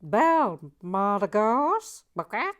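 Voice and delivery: loud, high Boston Nancy voice, badly done; bad Boston accent. To viewers, reviewing the set as Boston Nancy in a loud bad Boston accent. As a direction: belt it out in a terrible Boston accent. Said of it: "I can't do a Boston accent".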